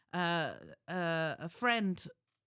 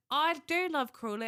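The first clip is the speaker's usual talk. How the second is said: backtracking protest; 7 semitones higher, 5 dB louder than usual. Retold or imitated. Retold backtracking after insulting friend's town.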